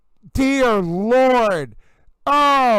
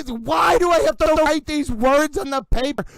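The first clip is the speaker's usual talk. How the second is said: usual speaking voice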